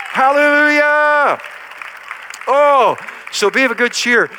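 loud, high rousing praise call; preaching at full force. Acted. Pastor calling the congregation to praise, rousing shout. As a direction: whip up the crowd to praise.

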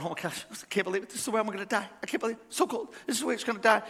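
soft, plaintive voice of a freezing bird; hammed-up hushed bird lament; despairing little bird. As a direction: murmur it like a doomed bird in the cold.

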